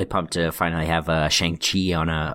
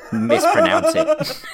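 high squeaky laughing words; 4 dB louder, slower than usual. Real, not acted. Laughing at the joke.

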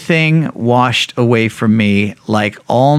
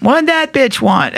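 high, slow, sneering quote of the roommate; a hint of a catty housemate; lightly voiced sneer; voicing the roommate; 13 semitones higher than usual. Roommate asks about the wife.

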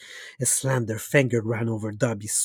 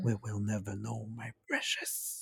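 hushed; 3 semitones lower, 13 dB quieter than usual. Acted. Smeagol quote, hushed.

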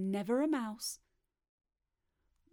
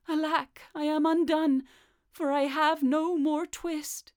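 raised, louder theatrical lament; 5 semitones higher, 6 dB louder than usual. A narrator's reading. Tailor by the fire fretting over his lost twist, lamenting. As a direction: build to a loud, high wail of woe.

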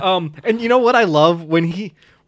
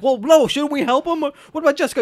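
higher-pitched, flustered questions; retold worried protest, pitched up; mildly worried; 7 semitones higher than usual. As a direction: object in mild mock alarm. Acts out Daniel's worried objection.

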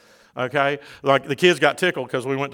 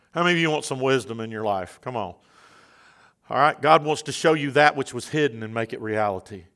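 ordinary talk, nothing marked

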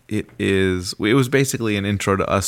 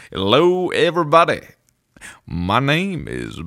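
second take with a more different voice; better host voice; 6 semitones higher than usual. Acted. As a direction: boom it out like a TV host. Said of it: "take two, take two".

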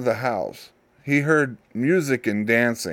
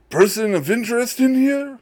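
mock haughty question; 12 semitones higher than usual. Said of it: "how he would sound".

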